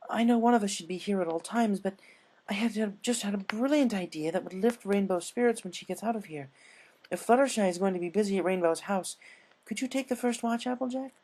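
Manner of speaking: refined Rarity. Acted. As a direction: play a poised, eager fashionista. Narrator voices Rarity.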